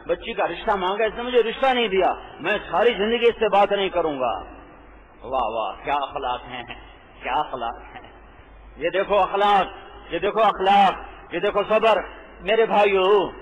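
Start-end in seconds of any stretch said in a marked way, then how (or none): 5.2-8.1 sarcastic. Acted. Sarcastic praise of bad conduct.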